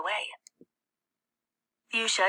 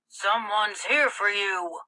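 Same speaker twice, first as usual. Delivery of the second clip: slow, gruff stepfather call; slow gruff call from the living room; gruff man voice; 2 semitones higher, 4 dB louder, slower than usual. A narrator's reading. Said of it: "Frank's gruff voice".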